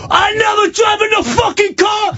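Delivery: very high, shouted mock outburst; going ballistic. Pizza man denies driving the car, ballistic high shout. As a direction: imitate a man exploding in high-pitched fury. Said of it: "He went ballistic".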